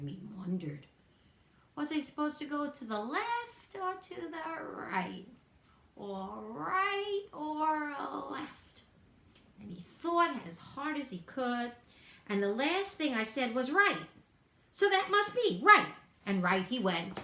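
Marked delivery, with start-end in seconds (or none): 1.7-8.8 high, soft and puzzled, slowing down at the end; a small rabbit muddling left and right; slow, wondering bunny voice; confused little bunny. A narrator's reading. Bunny confused about direction. 13.7-15.9 high and bright, sure of himself; the little bunny settling it happily; higher, louder bunny voice; bunny deciding. A narrator's reading.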